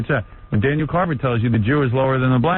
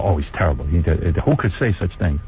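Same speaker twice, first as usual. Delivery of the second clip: mock shocked people, pitched much lower; mock outrage; 11 semitones lower than usual. Quoting hypocrites who act shocked, mock-scandalised low voice. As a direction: play the pearl-clutchers, low and scandalised. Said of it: "People go".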